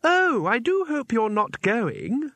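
a deranged girl pretending politeness; sweetly menacing girl. A narrator's reading. Isabel stopping the two visitors, high, slow and sugary.

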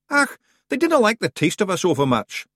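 high-pitched Scottish clansman, matter-of-fact; cocky Scottish Feegle. Acted. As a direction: play a high-voiced Scottish scrapper.

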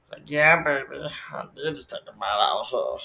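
playfully wicked mock gloating; mischievous Bateman impression. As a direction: gloat like a gleeful villain caught misbehaving. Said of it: "the little mischievous little face".

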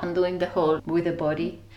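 ordinary delivery

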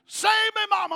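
high loud cry for help; desperate plea. Retold or imitated. Boy caught by alligator screaming to mama. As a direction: beg mama through the pain.

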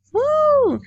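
high, loud cheer of joy; excited whoop. Real, not acted. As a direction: let out a real whoop of delight. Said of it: "I apologize if that was loud".

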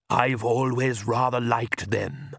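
put-on slow, wistful old vampire. A narrator's reading. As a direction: say it longing for the past. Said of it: "he said wistfully".

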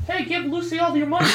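high, eerie mock ghost voice; the fake ghost speaking through Lucy, pitched high; thrown ghost voice. Friend imagining Lucy faking the ghost: high mock ghost voice. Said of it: "She's just throwing her voice".

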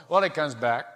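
ordinary talk, nothing marked

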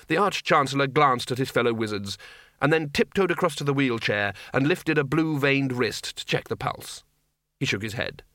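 plain reading voice

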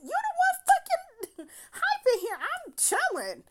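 very high, laughing protest; indignant disbelief. Retold or imitated. Woman answering his orders in her head, laughing indignation. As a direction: squeal it in amused indignation.

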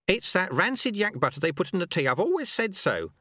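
high, brisk complaint; grumbling old master. A narrator's reading.

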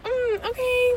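high, nervous little okay; a timid, scared mm okay. Retold or imitated. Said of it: "like, scared".

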